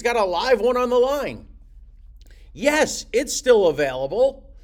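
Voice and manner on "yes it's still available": high, loud, eager yes; hopes-up homeowner, bursting to confirm it; excited seller. Acted. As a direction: answer like you've got a live buyer. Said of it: "he's jumping up and down".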